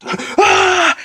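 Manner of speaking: sharp high gasp, then a cry; dramatic gasp. Pretend. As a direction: gasp as if swinging wildly. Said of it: "that's really dramatized".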